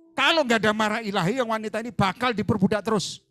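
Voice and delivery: loud, high preacher's declaration; fiery preaching. Acted. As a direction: proclaim holy anger. Preaching at full force. (khotbah lantang penuh semangat)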